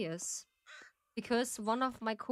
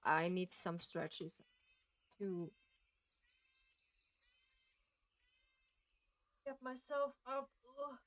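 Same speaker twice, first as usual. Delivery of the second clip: quiet mumbling; 5 semitones higher, 8 dB quieter than usual. Real, not acted.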